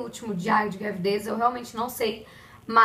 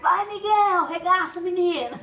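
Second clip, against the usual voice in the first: put-on cheering the baby on; 6 semitones higher than usual. Dancing with baby to music.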